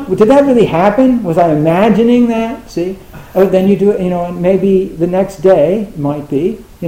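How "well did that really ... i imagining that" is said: loud, high, incredulous questioning; acted amazed doubt. Meditator doubting the glimpse of blankness, acting incredulous. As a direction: question it as if you can't believe it.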